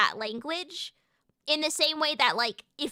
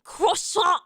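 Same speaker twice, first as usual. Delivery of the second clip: croissant in an exaggerated French accent; hammed-up mock French, higher pitched; heavy French accent; 5 semitones higher, 3 dB louder than usual. Shown as a demonstration. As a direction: overdo the French accent for fun. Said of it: "most offensive French accent for fun".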